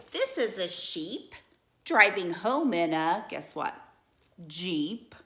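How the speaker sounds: lively storybook read-aloud; animated bedtime-story reading; reading to children. Acted. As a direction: read it like a picture book for kids.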